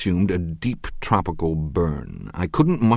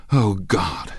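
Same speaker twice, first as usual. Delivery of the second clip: plain reading voice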